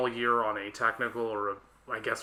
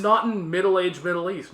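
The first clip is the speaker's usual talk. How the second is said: ordinary talk, nothing marked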